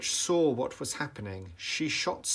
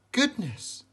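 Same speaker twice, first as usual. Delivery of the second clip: exclaimed; 11 semitones higher, 3 dB louder than usual. A narrator's reading. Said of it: "she exclaimed".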